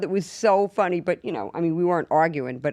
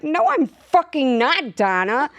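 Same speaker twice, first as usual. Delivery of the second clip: slow, higher, indignant retort; mock upset; 6 semitones higher, 4 dB louder, slower than usual. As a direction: replay your annoyed denial to the agent. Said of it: "I got really upset".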